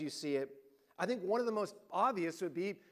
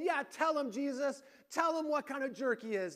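higher, egging Jesus on; eager crowd voice; 7 semitones higher than usual. Retold or imitated. Religious crowd urging Jesus to scold Zacchaeus, mock eager. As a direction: voice the smug onlookers. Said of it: "everyone's probably thinking".

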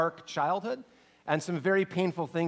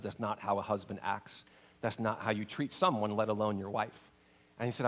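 low, soft contrite voice; sincere, soft repentance; humble apology; 8 semitones lower, 7 dB quieter than usual. Retold or imitated. Fred's humble apology retold.

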